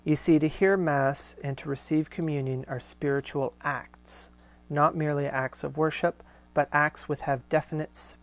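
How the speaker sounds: steady, fuller read-aloud voice; letter reading. Acted. Host reading Corinne's letter aloud to listeners, measured reading voice. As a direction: read the old letter out with care. Reads Marshall's reply aloud.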